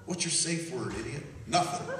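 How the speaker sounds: usual speaking voice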